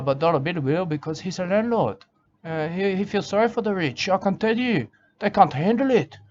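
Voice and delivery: usual speaking voice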